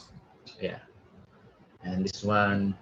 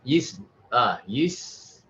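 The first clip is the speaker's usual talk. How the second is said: usual speaking voice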